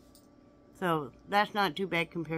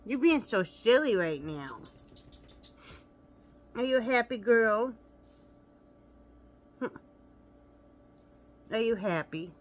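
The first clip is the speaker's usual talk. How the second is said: pet talk; 4 semitones higher, 4 dB louder than usual. Real, not acted. Cooing at her pet dog.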